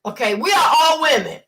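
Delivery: usual speaking voice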